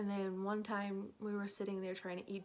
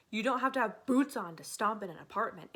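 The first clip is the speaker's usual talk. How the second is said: indignant retort; 6 dB louder than usual. Retold or imitated. Retold argument with the cops.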